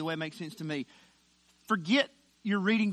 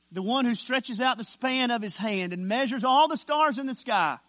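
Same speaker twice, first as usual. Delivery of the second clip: raised, sweeping pulpit cadence; preaching swell; 4 semitones higher, 6 dB louder than usual. Acted. Preacher exalting the one who measures the stars, swelling. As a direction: let it rise grandly through the phrase.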